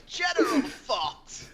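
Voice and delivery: drawn-out, high-pitched playful proclamation; mock General Zod cry. Podcaster riffing on a reboot of Zod, hammed-up villain cry.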